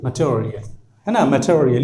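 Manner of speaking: "मटेरियल" as muh-cho-ri-yal; short uh first, ch-like T in "मटेरियल"; the true reading. Shown as a demonstration. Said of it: "ये पढ़ने का असल तरीका है".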